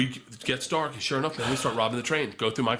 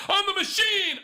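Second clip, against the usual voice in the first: high, loud catchphrase holler; proud, high-pitched party roar; retold triumphant yell; 4 dB louder than usual. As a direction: belt it out to a whole room. Answers the cheering room, retold.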